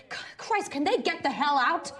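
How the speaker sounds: loud, high-pitched exasperated cry; agitated outburst. Acted. Woman losing patience with guests who linger, agitated. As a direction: burst out at the noisy guests, voice leaping up. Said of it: "Calm down, Jenny".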